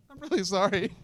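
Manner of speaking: choked up, with teary laughs; real tearful apology. Real, not acted. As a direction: apologise through tears. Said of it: "I didn't really wanna cry this much".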